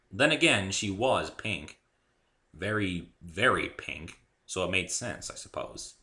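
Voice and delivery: plain reading voice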